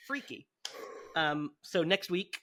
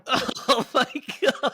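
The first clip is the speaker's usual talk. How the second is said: slow, high, loud through laughter; real laughing disbelief; 8 semitones higher, 7 dB louder, slower than usual. Real, not acted. Co-host reacts to the story, laughing. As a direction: groan it out while laughing.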